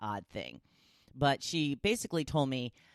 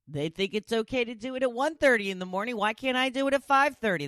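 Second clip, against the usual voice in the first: indignant, louder and higher; exasperated; 9 semitones higher, 5 dB louder, faster than usual. Real, not acted. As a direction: protest it with a huff. Exasperated rant about late fireworks.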